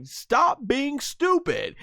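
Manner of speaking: high, drawn-out mock telling-off; hammed-up exasperated cry; imitated scolding. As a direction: scold slowly in a high cartoon voice. Acts out Bulma scolding.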